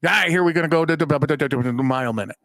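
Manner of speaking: rapid-fire imitation of a hurried doctor; imitating a motormouth surgeon at top speed; mile-a-minute patter. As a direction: rattle it off, words tumbling into babble. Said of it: "Still talking the same way".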